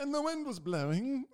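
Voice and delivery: high, hammed-up narrator voice; mock storyteller. Mock dramatic scene setting, then laughs.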